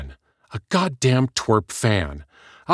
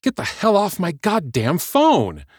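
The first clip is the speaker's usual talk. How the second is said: slow, higher, harsh order; harsh, troubled; 12 semitones higher, 4 dB louder than usual. Acted. Heather ordering the caller off her phone, harsh. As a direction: drive him off the line. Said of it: "Her tone was troubled, but also harsh".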